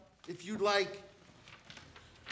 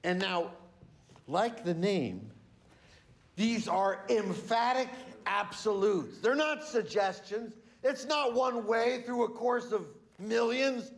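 ordinary talk

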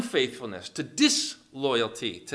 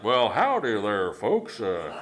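Southern airline captain. Acted. Captain breaking bad engine news to passengers, Southern accent. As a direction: play a folksy Southern pilot. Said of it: "like, Southern".

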